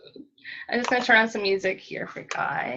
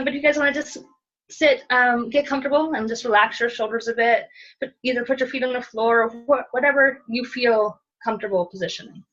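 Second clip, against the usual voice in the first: usual speaking voice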